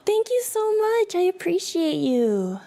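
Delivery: high-pitched, gushing thanks; touched streamer thanking a supporter; grateful excitement. Real, not acted. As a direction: start high and settle gratefully. Gasps, thanks viewer for support.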